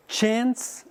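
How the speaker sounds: Chance with a flat American a, like "chens". Shown as a demonstration.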